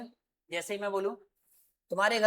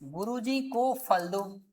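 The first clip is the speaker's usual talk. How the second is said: slow example; much slower than usual. Shown as a demonstration. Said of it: "और दूसरा क्या था".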